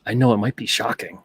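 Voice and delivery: usual speaking voice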